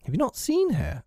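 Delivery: high, troubled question; growing concern. A narrator's reading. Peter asking silent boys about Wendy, troubled. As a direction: ask high, with mild worry. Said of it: "becoming troubled".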